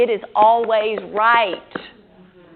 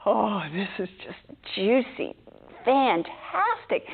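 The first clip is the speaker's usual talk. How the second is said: delighted; 5 dB quieter, slower than usual. Retold or imitated. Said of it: "you're like".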